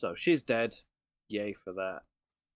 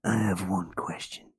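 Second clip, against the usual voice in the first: Snake Plissken impression; 5 dB louder than usual. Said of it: "do your best Snake Plissken impression".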